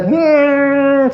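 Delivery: high, sustained "mm" through the nose; gunna sound. Shown as a demonstration. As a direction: hum "mm" long from the nose. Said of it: "The gunna sound is coming from my".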